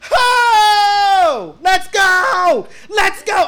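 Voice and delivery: high loud let's go cheer; cheering shout. Retold or imitated. Fan recreating the theater cheer, loud high shout. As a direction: yell it like the crowd.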